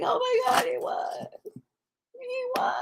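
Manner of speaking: very high, hushed weepy squeak; mock tearful. Imitating a viewer moved by Rocky's win, mock tearful squeak. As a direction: imitate being overcome with happy tears, tiny high voice. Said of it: "You just cry".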